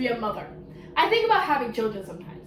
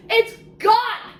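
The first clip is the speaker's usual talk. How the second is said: much higher, stressing gone; even more dramatic; 9 semitones higher, 5 dB louder than usual. Acted. Reviewer repeating the twist, pitched up for shock. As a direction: push it up for shock.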